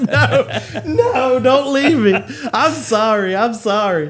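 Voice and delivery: high, hammed-up begging; mock tearful pleading. Boyfriend on hands and knees begs girlfriend, mock crying plea. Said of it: "And he's crying".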